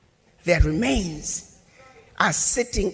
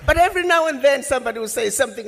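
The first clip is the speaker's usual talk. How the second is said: usual speaking voice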